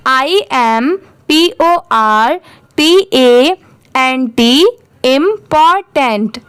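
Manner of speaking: measured letter-by-letter dictation with gaps; slow repeat-after-me spelling. Shown as a demonstration. Teacher has learners repeat after her, slow spelling drill. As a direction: spell it letter by letter, slowly, then the word. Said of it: "आप भी मेरे बात repeat करिए".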